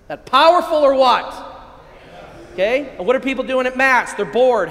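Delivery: loud, high-pitched fired-up preaching; real excitement. Real, not acted. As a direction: let your passion burst out at the congregation. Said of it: "I get a little excited".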